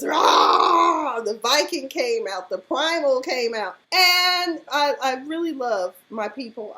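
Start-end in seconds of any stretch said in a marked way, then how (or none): 0.0-1.2 loud high mock roar; imitated meltdown roar. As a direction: roar like a raging Viking. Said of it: "he just".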